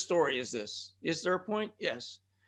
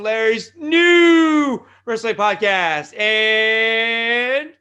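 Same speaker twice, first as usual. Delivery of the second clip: drawn-out, booming show-title shout; big announcer shout; 4 semitones higher, 12 dB louder, much slower than usual. Pretend. Host plugs the wrestling podcast, big announcer shout. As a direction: stretch the words loud and high like a ring announcer. Hyped plug of show's name.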